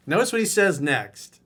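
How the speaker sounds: normal talking voice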